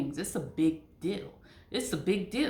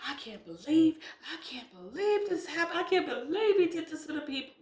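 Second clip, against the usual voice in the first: high, whiny mock disbelief, faster at the end; mocking shocked believer, higher pitched; 8 semitones higher than usual. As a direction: parody a churchgoer shocked by the scandal. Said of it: "You're gonna be like".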